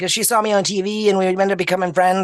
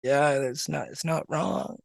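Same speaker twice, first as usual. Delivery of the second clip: small, soft, bashful admission; sheepish; 4 semitones lower, 10 dB quieter, slower than usual. Retold or imitated. Skater admitting to the booth guy the praise is true, sheepish. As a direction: own up shyly, much quieter. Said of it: "just sheepish".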